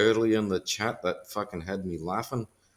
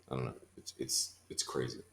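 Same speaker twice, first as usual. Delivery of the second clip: ordinary delivery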